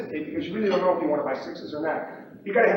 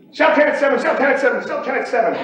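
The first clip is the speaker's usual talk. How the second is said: loud, high, repeated trading yell; urgent pit shouting; 7 semitones higher, 7 dB louder than usual. Retold or imitated. Trader re-enacting the pit when cash hit, shouting urgently. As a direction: fire off sell orders across a noisy pit.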